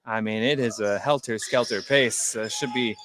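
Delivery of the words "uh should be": usual speaking voice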